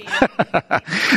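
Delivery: short real chuckle; laugh. Real, not acted. Preacher to congregation after a declaration, short chuckle. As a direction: let out a quick run of chuckles.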